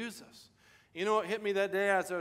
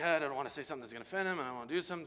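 nervous rush; much faster than usual. Retold or imitated. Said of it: "And I'm like".

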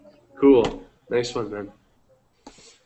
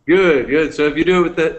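normal talking voice

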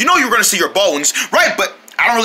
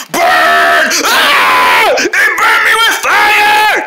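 pained yelling; 6 semitones higher, 5 dB louder, much slower than usual. Acted. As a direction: scream in pain from the burn. Lizard burns him with fire.

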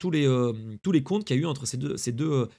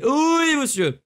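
triumphant cheer; 11 semitones higher, 6 dB louder, much slower than usual. Real, not acted. Cheers as account gets disconnected.

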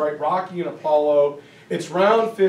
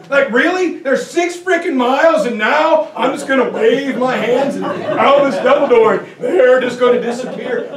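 high, loud disbelief breaking into laughter; incredulous outburst; 6 semitones higher, 6 dB louder than usual. Retold or imitated. Lee hearing he must save Richmond, incredulous laughing shout. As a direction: shout it like the job is a joke. Lee reacts to being handed the army.

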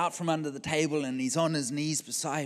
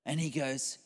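usual speaking voice

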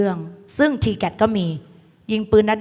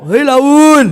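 stretched mock shout, raised in pitch; mock gang call-out; 4 semitones higher, 8 dB louder than usual. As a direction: imitate a gang hollering at someone. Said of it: "ไอ้แก๊งเลโอบอก".

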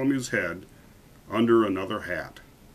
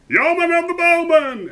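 very high, loud call; echoing crowd shout; 8 dB louder than usual. A narrator's reading. Lords echoing the king's summons, high shout. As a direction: shout it high like a whole court. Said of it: "echoed the lords and noblemen".

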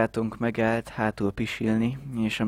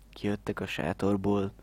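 normal talking voice

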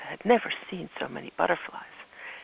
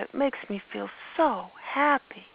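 put-on happy child; 7 semitones higher, 5 dB louder than usual. Olivia happy.

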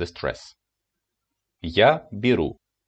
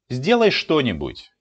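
urgent command; 4 semitones higher, 5 dB louder than usual. Acted. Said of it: "example command".

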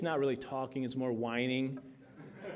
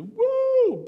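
excited whoop; 9 dB louder than usual. Pretend. Celebrating mountain moved.

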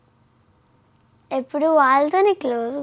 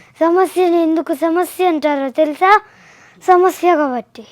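loud, forceful angry dialogue; pretend anger; 3 semitones higher, 6 dB louder than usual. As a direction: act it out angrily, hitting each word hard. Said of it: "కోపంగా చెప్పాలి ఇప్పుడు".

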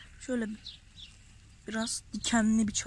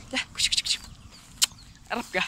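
calling a puppy; much faster than usual. Real, not acted. Farmer coaxes a frightened stray puppy, breathy calling. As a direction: coax the stray pup over in a breathy call.

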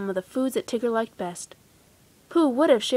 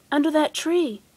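higher-pitched calling out; a mild shout, read for a story; storybook shout; 5 semitones higher than usual. A narrator's reading. Rabbit directs the friends under a tree, mild shout. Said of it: "he shouted".

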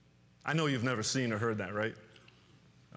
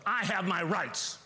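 petulant demand; 5 semitones higher, slower than usual. Acted. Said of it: "the cry of self".

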